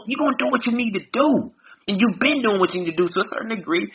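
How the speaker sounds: loud, fired-up sermon delivery; motivational preaching. Acted. Host firing up his trailblazer listeners, preaching.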